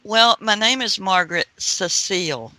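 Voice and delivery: normal talking voice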